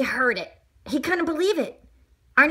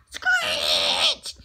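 screech. A narrator's reading. The car comes to a stop, screeching. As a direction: screech like brakes stopping a car.

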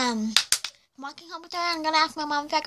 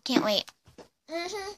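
ordinary delivery

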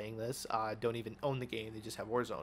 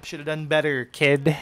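cocky jab, a bit louder; taunting; 3 semitones higher, 13 dB louder than usual. Real, not acted. Gamer taunting an opponent he just killed, cocky jab. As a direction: needle the beaten player.

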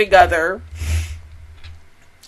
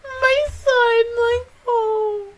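high, soft, aching whimper; soft high voice, close to crying; tearful; 10 semitones higher, 6 dB quieter, much slower than usual. Real, not acted. Fan aching for her beloved Yuki, tearful.

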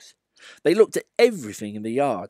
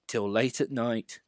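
plain reading voice

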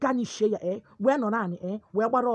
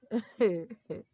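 brief, spaced-out chuckle; short chuckle. Real, not acted. Speaker ranting about women and news, amused chuckle. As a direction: chuckle in a couple of short bursts. Laughs during rant.

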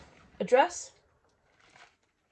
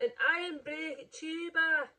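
laughing; 4 semitones higher than usual. Real, not acted.

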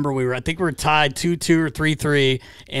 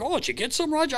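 teasing dad; 13 semitones higher, 5 dB quieter, faster than usual. Retold or imitated. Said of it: "He's like".

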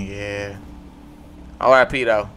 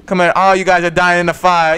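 ordinary talk, nothing marked

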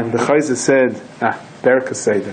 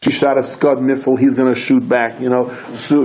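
ordinary delivery

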